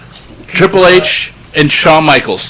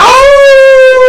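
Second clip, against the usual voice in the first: excited shout; 4 dB louder than usual. Real, not acted. Excited wrestling argument.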